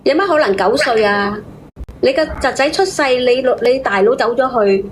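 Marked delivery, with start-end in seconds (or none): none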